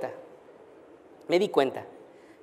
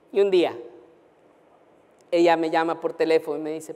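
ordinary talk, nothing marked

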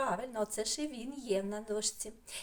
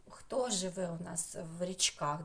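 ordinary talk

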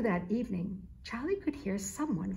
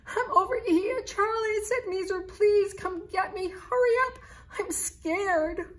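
scared kitten; 10 semitones higher, 4 dB louder than usual. A narrator's reading. Scared kitten calling for help.

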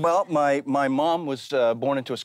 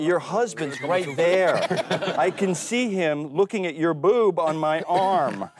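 slow, wary aside; 5 semitones higher, slower than usual. Retold or imitated. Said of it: "and then I go".

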